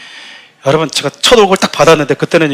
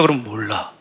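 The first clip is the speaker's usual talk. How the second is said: usual speaking voice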